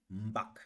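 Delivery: "mbak" dropped to a low tone. Shown as a demonstration. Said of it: "Je fais un abaissement dans la voix".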